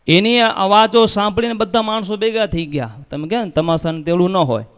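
ordinary talk

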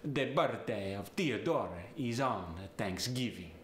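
Italian accent, th hardened to d or t; Italian "Theodore" and "Thanksgiving" starting with t. Shown as a demonstration. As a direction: harden every th in "birthday" and "Theodore", Italian style. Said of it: "both get the D sound".

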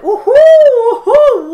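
high, slow hooting owl call. Acted. Owl greets the animals with a high hoot. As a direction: hoo like an owl on a post.

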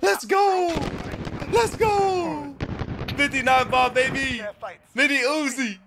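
high, drawn-out celebratory yelling; real high-pitched excited shouting; victory cheer. Real, not acted. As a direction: holler in triumph after winning. Celebrating a win.

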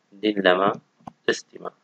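normal talking voice

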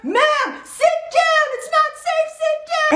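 high, urgent crew warning; mock panicked high-pitched yell; imitated yelling. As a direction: order a passenger back to the seat. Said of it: "the cabin crew were yelling at her".

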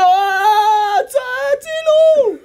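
shrill, very high scream of help. Acted. The poor man screaming help at the approaching figure, very high and loud. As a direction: scream help at the top of the lungs. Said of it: "צועק לו".

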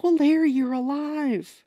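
high, slow, drawn-out greeting; hammed-up joy at seeing a friend; mock delighted surprise. Imitating him as Crystal comes upstairs, mock delight.

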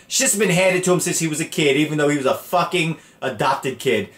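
ordinary talk

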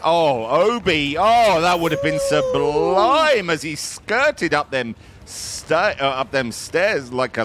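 high, drawn-out cry of admiration; excited commentary. Real, not acted. Caster marvelling at Obi's near-sublime play, excited.

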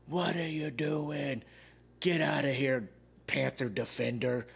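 imitating a drunk heckler, slow and stretched; mock the drunk guy yelling at the field. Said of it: "he had to yell at everything".

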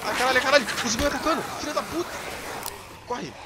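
high-pitched, real alarm; panicked cursing. Real, not acted. Gamer attacked by a zombie, panicked cursing. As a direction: yelp curses while under attack.

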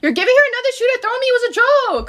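high fast protest; indignant outcry. Real, not acted. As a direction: yelp it in disbelief. Protesting dad handing another shoe.

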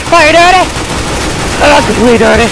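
loud, high-pitched yelping challenge; worked-up taunt. Real, not acted. Gamer shot through a wall by a sneak, loud high taunt. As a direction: yell it high at the opponent.

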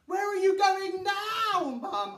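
put-on high, loud child's call; child calling after her leaving mother. A narrator's reading. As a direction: shout after mum across the house.

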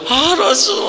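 high, loud delighted exclamation; overjoyed cry. Retold or imitated. Former slave recognizing the Prophet, crying out with joy. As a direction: burst out with joy at recognizing him. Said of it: "begitu senang".

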